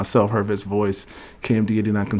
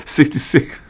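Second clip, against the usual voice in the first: higher, words caught in laughter; laughing; 5 semitones higher, slower than usual. Real, not acted. Host answering viewer sixty-six, laughing. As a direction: say it through a laugh.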